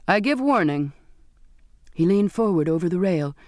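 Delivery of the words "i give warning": raised pitch, a stern warning; rising voice. A narrator's reading. Captain warns the assembled crew, voice rising. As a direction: let the voice rise into a warning. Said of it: "his voice rising with the wind".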